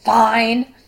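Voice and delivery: curt, loud "fine" that means not fine; loud, sharp one-word brush-off. Acted. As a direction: snap it out sharply and loudly. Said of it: "with a very sharp tone and maybe a little bit louder".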